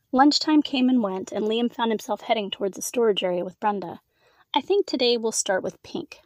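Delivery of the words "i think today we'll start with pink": plain reading voice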